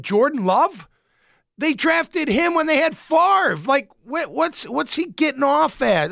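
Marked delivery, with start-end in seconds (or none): 1.6-6.1 incredulous rant. Real, not acted. Ranting about Rodgers.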